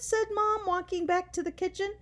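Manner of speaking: plain reading voice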